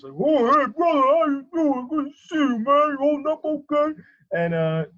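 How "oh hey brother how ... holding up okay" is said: much higher, loud, beaming yell. Retold or imitated. Checking in on [name], happy high yelling. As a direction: greet a buddy with a big happy holler. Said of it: "He talks in that happy yelling voice".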